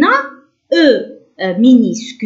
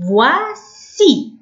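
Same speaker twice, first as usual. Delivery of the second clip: slow, higher, two long syllables of فواسي; 6 semitones higher, much slower than usual. Shown as a demonstration. Teacher explaining c before i, syllable by syllable. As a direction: sound out فواسي with a clear s. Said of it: "نقولوه كالاس".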